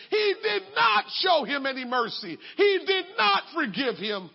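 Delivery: loud, impassioned scripture reading; preacher thundering the parable; preaching. Acted. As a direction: preach it out with fire. Preaching scripture at full force.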